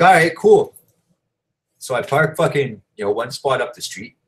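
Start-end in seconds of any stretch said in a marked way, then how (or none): 0.0-0.7 eager. Retold or imitated. Retells his own eager agreement.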